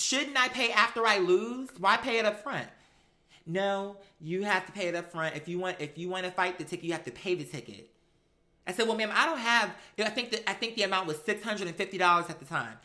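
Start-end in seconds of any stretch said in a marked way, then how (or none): none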